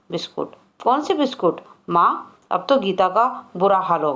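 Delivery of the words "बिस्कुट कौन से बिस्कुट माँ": playing dumb so father won't know; feigned innocence. Boy feigns ignorance to avoid beating. (भोलेपन का नाटक करते हुए)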